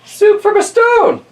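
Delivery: put-on high, slow, amazed old lady; squeaky old lady. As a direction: marvel like a tiny old woman. Old lady marvels in story.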